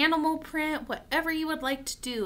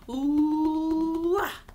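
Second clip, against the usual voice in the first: long gorilla hoot; gorilla call; 3 semitones higher, 6 dB louder than usual. Acted. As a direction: draw out the ooh, then burst into ah. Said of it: "We're a gorilla".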